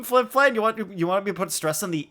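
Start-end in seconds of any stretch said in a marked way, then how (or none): none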